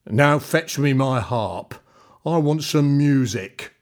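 loud, slow giant's command; demanding giant. A narrator's reading. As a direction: order it heavily. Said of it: "Then he demanded".

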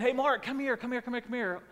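high-pitched imitation of uncle calling out; mock holler across the pool; loud calling. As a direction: call him over, rapid and insistent. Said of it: "he's doing it real loud".

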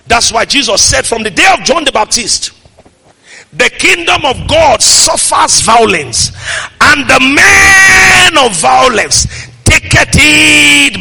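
loud preacher, words stretched at the climax; full-force preaching. Acted. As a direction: declaim scripture with mounting force.

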